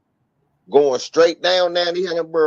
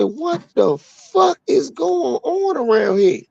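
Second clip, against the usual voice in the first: high, slow mock outcry; retold shock; 11 semitones higher, slower than usual. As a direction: stretch it out in acted disbelief. Retold shocked reaction to the answer.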